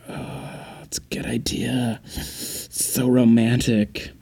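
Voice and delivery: put-on hushed, swooning girl whisper; a mock-romantic hush; breathy romantic whisper. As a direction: whisper it like a dreamy movie girlfriend. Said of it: "the girl will be like".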